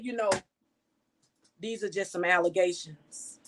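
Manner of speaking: ordinary talk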